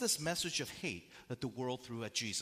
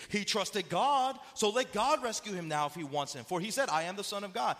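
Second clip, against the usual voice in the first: raised, loud, taunting recitation; mocking; 6 semitones higher, 6 dB louder than usual. Haters turn Jesus' own words against him, mocking. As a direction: throw his own words back at him, jeering. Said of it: "They're mocking Jesus".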